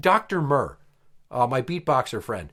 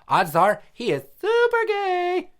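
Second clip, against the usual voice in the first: high, drawn-out camp caricature; 3 dB louder, slower than usual. Acted. Dad demonstrates to daughters, high flamboyant camp voice. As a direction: swoop up high and stretch it flamboyantly. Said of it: "he sounds like this".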